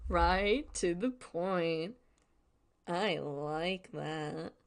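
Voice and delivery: very slow, drawn-out mimicry of a TV character; mimicking the TV. Viewer echoing the flirting TV's line, slow drawn-out mimicry. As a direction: stretch each word out, savoring it.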